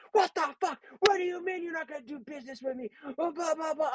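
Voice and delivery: high-pitched outraged customer rant; losing it. Retold or imitated. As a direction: mimic an irate customer blowing up, voice shooting up high. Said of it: "this fucking chick lost it".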